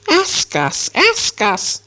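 high, insistent creature voice, hissed esses; Gollum. A narrator's reading. Gollum presses Bilbo for a question, shrilly insistent. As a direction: needle them in a high hissing voice. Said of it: "said Gollum".

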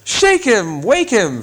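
high, impatient call; high impatient elves shouting. A narrator's reading. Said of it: "shouted the others impatiently".

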